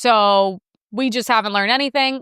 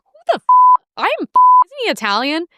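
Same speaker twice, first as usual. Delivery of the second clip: high-pitched, incredulous mock retort; indignant disbelief; 12 semitones higher, 6 dB louder, faster than usual. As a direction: act out being baffled and affronted. Imagined outraged retort to Cuomo.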